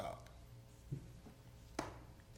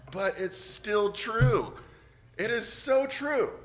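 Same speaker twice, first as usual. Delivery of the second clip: much higher and louder, slow, insisting it's true; emphatic insistence; 8 semitones higher, 8 dB louder, slower than usual. Real, not acted. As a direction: proclaim it, pitched up and forceful.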